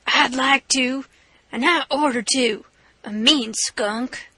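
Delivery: slow, strained voice of a captive robber; bound man's voice. Acted. Tied-up robber to his captors, slow and strained. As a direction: drag the words out, cornered and spiteful. Turner pleads, bound on floor.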